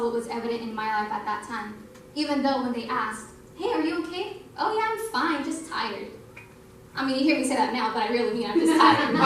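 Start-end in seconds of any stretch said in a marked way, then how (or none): 3.6-4.4 lightly voiced friend asking if she's okay, pitched up; a hint of a worried friend checking in; friend's concerned question. Friends asking how she is. 4.6-6.1 mock-cheerful "I'm fine", pitched up then dropping; breezy brush-off. Brushing off friends' concern.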